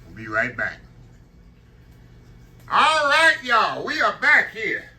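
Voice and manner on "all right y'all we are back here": high, loud and slow cheer; excited. Real, not acted. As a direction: call out the comeback with joy. Excited return to camera.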